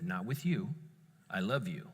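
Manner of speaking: low, soft and sincere; quiet reassurance. Retold or imitated. Dad reassuring child he loves her, tender. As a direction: reassure gently, keeping the voice down.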